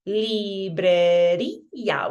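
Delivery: "librería" very slow, stressed lee-breh-REE-a; accent breaks diphthong. Shown as a demonstration. Teacher showing a broken diphthong, stressed í in "librería". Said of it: "by doing an accent".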